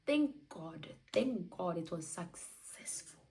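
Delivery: slow whispered thanks; whispered relief. Real, not acted. Epidural worked, slow whispered relief. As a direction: breathe out the relief slowly.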